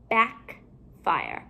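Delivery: BACKFIRE with a gap between "back" and "fire"; two separate parts. Shown as a demonstration. Teacher building compound words for pupils, halves kept apart.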